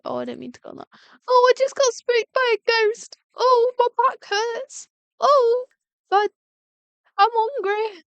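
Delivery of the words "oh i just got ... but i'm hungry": high, whiny complaining voice; mock moaning. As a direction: moan each complaint like a sorry victim.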